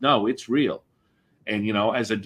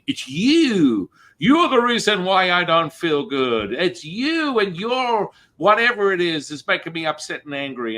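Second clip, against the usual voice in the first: higher, louder accusing cry of it's you; blaming accusation; 8 semitones higher, 4 dB louder than usual. Retold or imitated. Person venting their anger at someone, high and accusing. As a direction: act out someone pointing the finger, upset.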